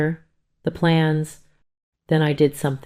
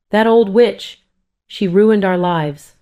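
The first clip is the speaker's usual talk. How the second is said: a loud, higher yell, the second line slowed; furious shout; 4 semitones higher, 6 dB louder, slower than usual. Acted. Jessica shouting furiously at Margaret in the background. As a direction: scream the insult from across the room. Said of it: "shouting from behind".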